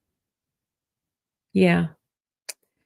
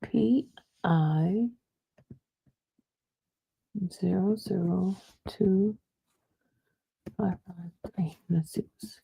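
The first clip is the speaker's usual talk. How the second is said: whispering; 10 dB quieter than usual. Real, not acted. Reading case number under breath.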